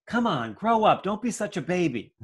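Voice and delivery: high, loud impatient scolding; harsh scolding father. Retold or imitated. Irritated father scolding his scared child, loud and harsh. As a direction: snap at the child to toughen up.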